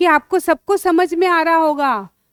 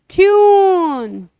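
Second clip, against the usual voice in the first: slow blending; 3 semitones higher, 4 dB louder, much slower than usual. Shown as a demonstration. Teacher blends sounds into word.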